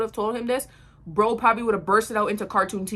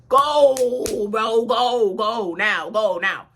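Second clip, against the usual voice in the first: loud, drawn-out first go, then repeated; retold urgent call to go; urgent urging; 6 dB louder, much slower than usual. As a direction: urge the driver loudly to get moving. Urging driver to leave.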